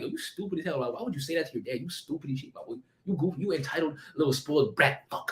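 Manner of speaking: hushed self-scolding inner voice. Retold or imitated. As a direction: scold yourself under your breath. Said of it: "the voices were going crazy in my head".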